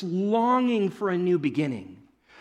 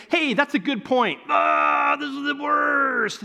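mock complaint; 6 semitones higher than usual. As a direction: sound like one more grumbling voice. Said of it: "It's not gonna be like".

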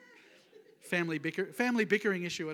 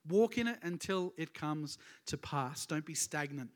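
ordinary talk, nothing marked

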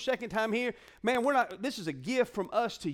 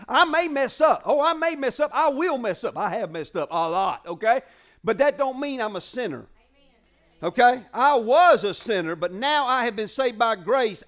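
louder preaching, building up; forceful preaching; 7 dB louder than usual. Real, not acted. Pastor declares to the church, loud and building. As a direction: preach it out strong.

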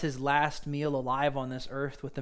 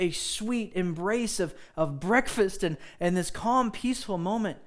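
higher, louder, building fervent appeal; impassioned preaching; 5 semitones higher, 3 dB louder than usual. Real, not acted. Pastor urging the congregation that Jesus finds them, impassioned preaching. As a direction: preach it out to the whole room.

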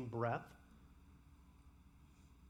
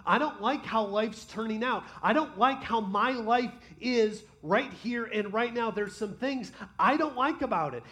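honest complaint to God; 5 semitones higher, 7 dB louder than usual. Acted. Congregant's honest complaint prayer to God.

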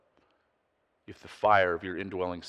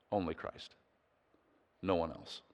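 hushed emphasis; 3 semitones lower, 9 dB quieter than usual. Real, not acted. Quiet emphatic close.

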